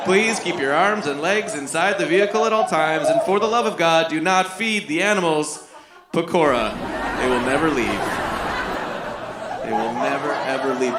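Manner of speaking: low, measured tour-guide patter. Acted. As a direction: narrate the sights like a solemn safari guide. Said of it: "pointing at her house, saying".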